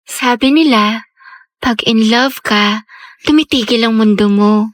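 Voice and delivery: slow, low narration; dreamy love-story narrator, measured and low; romantic voiceover. Acted. Voiceover musing on falling in love.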